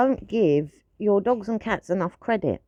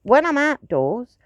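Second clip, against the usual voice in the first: ordinary delivery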